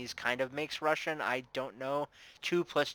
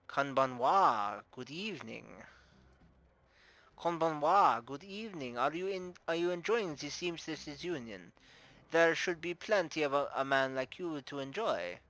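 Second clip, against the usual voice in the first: put-on lilting, higher-pitched Russian-accented hostess; 3 semitones higher than usual. Bar owner greeting a newcomer, playful Russian accent. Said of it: "I'm doing a Russian accent".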